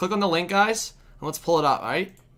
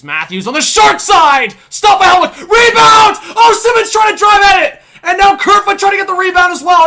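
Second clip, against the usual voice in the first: loud high shouting over a goalmouth scramble; frantic play-by-play shout; 17 dB louder than usual. Real, not acted. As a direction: scream every rebound. Live hockey scramble in front.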